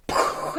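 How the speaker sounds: airy, voiceless imitated blast; explosion noise. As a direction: puff out a firework burst. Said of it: "as it explodes".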